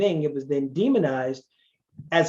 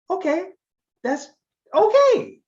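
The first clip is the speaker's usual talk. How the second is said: squeaky much higher, louder bemused okay; mock surprise; 5 dB louder than usual. Speaker reacting to the Italian's answer, high bemused surprise. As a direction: act baffled but accepting. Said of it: "And I'm like".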